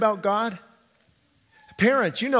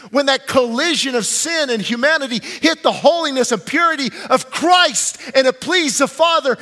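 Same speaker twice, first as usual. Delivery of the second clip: loud, raised-pitch sermon climax; impassioned preaching; 5 semitones higher, 5 dB louder than usual. Acted. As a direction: preach it with mounting force. Preaches at full force.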